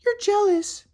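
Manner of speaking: high falsetto accusation; mocking his ex. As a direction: toss it at him in a high put-on voice. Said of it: "and then she's like".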